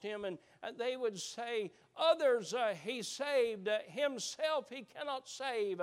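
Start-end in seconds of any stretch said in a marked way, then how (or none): 2.0-5.8 loud sneering taunt from the crowd; mocking jeer. Preacher voicing the crowd mocking Jesus, a loud sneer. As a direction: throw the words up at him with scorn.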